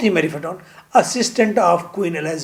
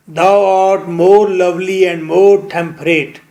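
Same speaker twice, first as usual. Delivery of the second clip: slow, measured recital of the line; poem recitation; much slower than usual. Shown as a demonstration. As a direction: read it like verse, drawing each word out. Teacher recites a sonnet line. (धीमे, कविता की तरह पाठ)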